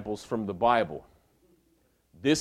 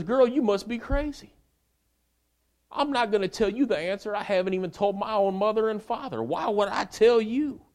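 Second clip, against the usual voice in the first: higher and louder, fed-up refusal; exasperated Samson; 12 semitones higher, 5 dB louder than usual. Acted. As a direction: push it up high, totally done with her. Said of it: "Actually, that's a paraphrase".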